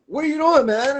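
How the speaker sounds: high, loud "what are you doing"; a rattled driver calling out after the crash; startled outburst. Retold or imitated. A rattled driver yelling at the guy who hit him, high and loud.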